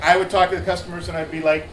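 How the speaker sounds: ordinary talk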